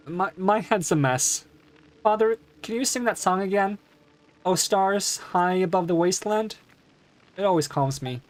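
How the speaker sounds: a daughter begging her father; young girl voice. Acted. Daughter voice again.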